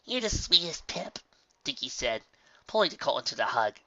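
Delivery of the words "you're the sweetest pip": tender. A narrator's reading. Filly tender.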